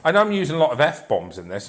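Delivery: ordinary talk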